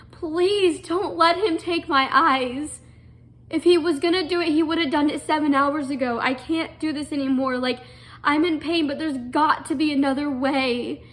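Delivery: desperate pleading. Retold or imitated. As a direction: beg as if nothing else will stop the surgery. Begging mom before eye surgery.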